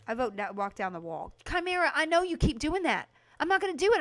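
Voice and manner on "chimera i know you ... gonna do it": loud, high pushback; playfully indignant refusal; exasperated protest. Real, not acted. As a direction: refuse a nagging friend, voice raised. Pushes back at a viewer's suggestion.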